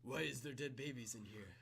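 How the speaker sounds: understated, laid-back skater-guy voice; deadpan surfer dude, amused; underplay it like a mellow surfer; Keanu Reeves. Acted. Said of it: "like, more like this".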